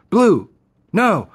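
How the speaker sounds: high, loud call across the field; shouting at a dog. Retold or imitated. Son calling his runaway dog, loud yell. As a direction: yell the dog's name to stop it.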